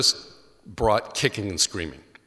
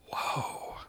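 breathless, unvoiced whoa; awed whisper; 11 dB quieter than usual. Boy in the choir watching Herm collapse: hushed whisper. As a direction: whisper it in stunned awe.

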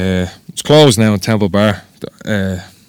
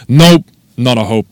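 louder, emphatic dismissal; firm refusal; 3 semitones higher, 7 dB louder than usual. Acted. Parents imagined turning down a tattoo request, firm refusal. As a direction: shut it down with force.

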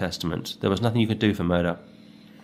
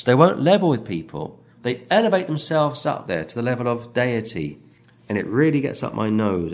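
ordinary delivery

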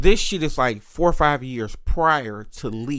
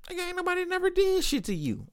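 fast, high-pitched squeaky disbelief; high mocking retort; 6 dB quieter, faster than usual. Sibling answering brother's excuses, in a high, quick mocking voice. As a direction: shoot up high and rush it, softly incredulous.

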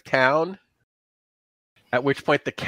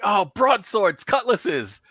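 higher, pleased half-laughing; delighted find; 7 semitones higher, slower than usual. Acted. Harry finding weapons in the shop, higher and delighted. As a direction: marvel at the pile of weapons. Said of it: "you're like".

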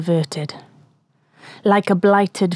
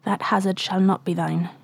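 softer, fading into a whisper; soft tender refusal; 2 semitones lower, 6 dB quieter than usual. Acted. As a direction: gently send him away, almost whispering. Ahab gently refusing Starbuck.

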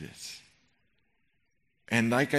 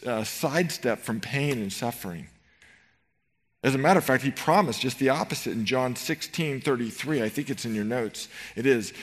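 ordinary talk, nothing marked

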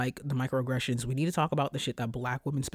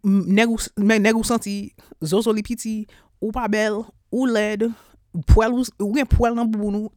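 louder, higher cursing in Creole; raised voice hurling Creole curses at coworkers; Creole cussing rant; 6 semitones higher, 7 dB louder than usual. Acted. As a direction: let the Creole insults fly, loud. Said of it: "I'm cussing people I don't like".

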